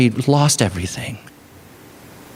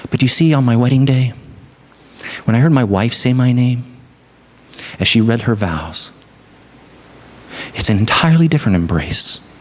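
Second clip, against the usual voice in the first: usual speaking voice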